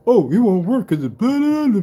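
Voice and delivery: high-pitched mock of a naysayer; mocking imitation. Imitating naysayers who doubt the product testing, mocking. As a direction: dismissively parrot the critic, rising into blahs. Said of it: "and you go".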